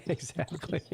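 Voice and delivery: laughing through the word. Real, not acted. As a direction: say it while laughing. Laughing at the joke.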